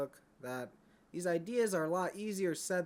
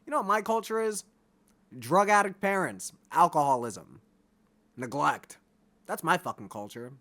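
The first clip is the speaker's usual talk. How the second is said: louder, hammering each word out; loud emphatic rant; 2 semitones higher, 7 dB louder than usual. Real, not acted. Telling viewers his culture, in a loud emphatic rant. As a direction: spit the list out like accusations.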